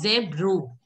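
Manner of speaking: zebro with a full final vowel, "zeb-roo"; wrong take, zebro ending in a rounded "ro"; mispronunciation demo. Retold or imitated. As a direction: imitate students adding a vowel to zebro. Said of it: "you are pronouncing it as".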